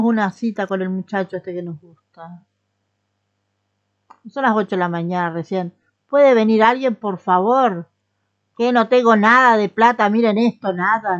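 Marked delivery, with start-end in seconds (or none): 6.1-11.2 loud call for customers; loud plea to passers-by. Real, not acted. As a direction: shout across the shop for someone to come. Calling out to passing customers.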